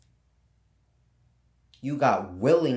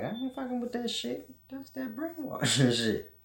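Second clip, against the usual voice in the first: high put-on voice, scoffing at school books; a higher, mocking voice waving off the idea with a laugh; mock native refusal; 13 semitones higher than usual. Vlogger voicing natives who reject school books, high mocking scoff. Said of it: "The natives is like".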